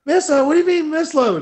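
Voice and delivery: high, loud, outraged questioning; incredulous shout. Retold or imitated. Lifter told of a misload, shouting back incredulously. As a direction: throw the word back in loud disbelief. Acting his shocked reply to misload.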